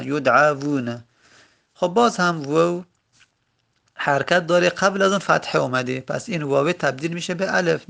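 usual speaking voice

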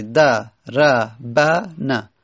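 دربنه stretched syllable by syllable, all four fathas audible; very slow syllabified. Shown as a demonstration. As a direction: draw دربنه out very slowly for the class. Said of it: "چهار تا فتحه". (بسیار آهسته و هجا به هجا)

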